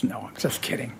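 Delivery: breathy whispered aside. Real, not acted. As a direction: whisper it like a secret. Whispered retraction after joke.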